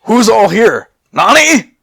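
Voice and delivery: high, loud call; loud high searching call. Acted. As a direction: call across the clearing. Sissel calling out.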